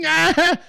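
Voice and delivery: high-pitched anguished "ah"; hammed-up stressed yelp; stressed-out groan. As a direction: act out the strain bursting out as a high cry. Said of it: "that's so stressful".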